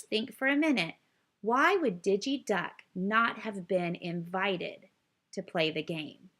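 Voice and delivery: plain reading voice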